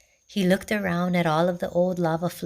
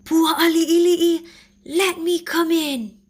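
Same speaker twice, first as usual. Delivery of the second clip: high, loud, slow monster call; Mo'o yelling at the piglets' door, high and loud; yelling lizard; 11 semitones higher, 6 dB louder than usual. A narrator's reading. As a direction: bellow it at the door like a hungry beast.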